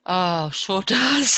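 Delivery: slow, loud, laughing through the words; laughing yes. Real, not acted. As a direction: let the laugh break up the answer. Laughing agreement to question.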